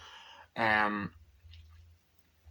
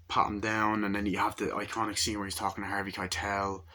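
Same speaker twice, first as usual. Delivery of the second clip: ordinary talk, nothing marked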